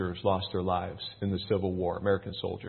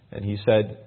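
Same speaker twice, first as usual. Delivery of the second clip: raised, louder Bible-reading voice; scripture reading; 3 semitones higher, 7 dB louder than usual. Acted. Pastor reading the Bible to his church, raised and declaiming. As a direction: read it out like a preacher to the congregation.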